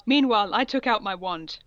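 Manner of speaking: ordinary talk, nothing marked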